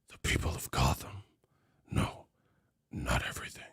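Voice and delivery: voiceless hushed whisper. Acted. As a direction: drop to a secret whisper. Hushed Batman aside.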